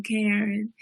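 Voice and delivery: ordinary talk, nothing marked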